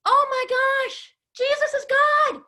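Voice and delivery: high, loud, stretched-out gasp of wonder; hammed-up astonishment for kids; amazed disciples. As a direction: play it like you just saw a miracle. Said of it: "They're like".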